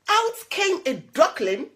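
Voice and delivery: plain reading voice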